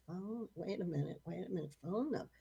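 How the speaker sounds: soft, thinking aloud; retold pondering. Woman pondering over a borrowed phone, softly thinking aloud. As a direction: puzzle it out quietly to yourself. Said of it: "she's pondering and saying".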